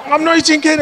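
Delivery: defiant protest. Retold or imitated. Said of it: "and I said".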